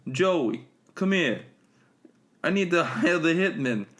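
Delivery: high, loud hammed-up New York mobster; bad gangster voice. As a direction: put on a cheesy Sopranos-style wiseguy. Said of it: "that's my, my bad gangster voice".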